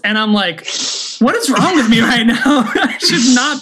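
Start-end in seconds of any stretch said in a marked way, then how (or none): 1.2-3.6 laughing. Retold or imitated. Mock self-scolding while laughing.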